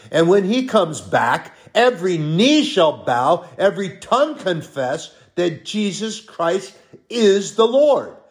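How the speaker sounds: high, loud preacher's proclamation; emphatic preaching. Real, not acted. Preaches the climax.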